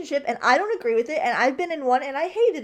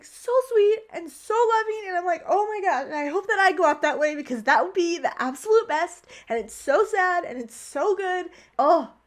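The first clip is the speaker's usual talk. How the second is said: higher-pitched, emotional gushing; tearing up; 6 semitones higher than usual. Real, not acted. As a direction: gush while getting real tears in your eyes. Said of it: "tearing up for real".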